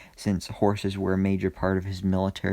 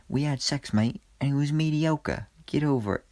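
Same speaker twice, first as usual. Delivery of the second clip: a clumsy put-on British accent, pitched up; terrible British accent; 6 semitones higher than usual. Reviewer imitates Charlie brushing off Behrad, bad British accent. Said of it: "that was a terrible accent".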